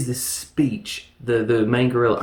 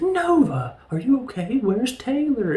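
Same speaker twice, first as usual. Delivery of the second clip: much higher, lightly voiced worried Zira; voicing Zira; 12 semitones higher than usual. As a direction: call out to Nova in a high fret. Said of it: "she's like".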